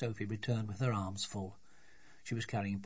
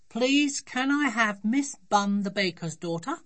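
triumphant little daughter; 11 semitones higher, 7 dB louder than usual. Acted. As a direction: ask with gleeful politeness as a small girl.

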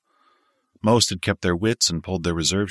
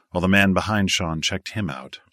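ordinary delivery

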